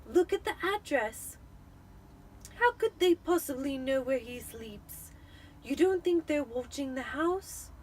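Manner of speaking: quivering voice. Acted. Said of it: "in a quivering voice".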